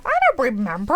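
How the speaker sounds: ordinary delivery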